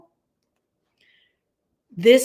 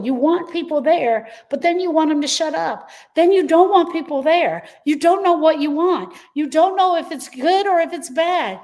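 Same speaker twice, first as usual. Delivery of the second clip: high-pitched exasperation; 7 semitones higher, 4 dB louder than usual. Real, not acted. Coach to grieving followers, rising exasperated outburst. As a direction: pitch up, louder, swept along by frustration. Coach venting grief's chaos.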